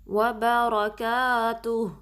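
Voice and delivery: slow, drawn-out Quranic recitation. Shown as a demonstration. Teacher reciting the phrase for students to copy. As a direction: chant it slowly with a heavy lam on اللَّهِ. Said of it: "وا one count".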